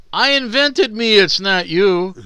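high, loud whiny shout; George Costanza quote. Retold or imitated. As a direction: whine it like an outraged Costanza. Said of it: "to quote a George Costanza line".

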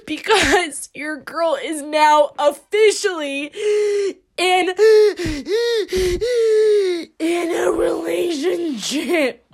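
high, slow, laughing reveal; mock announcement. Host teasingly announcing she has a boyfriend. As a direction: announce it like big news, laughing.

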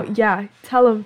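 ordinary talk

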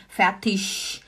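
fertig drawn out slowly, ending in a sh sound; slow fertig with a hushed sh ending; village dialect fertig. Shown as a demonstration. Teacher slowly showing the village pronunciation. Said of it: "que eles falam fertig".

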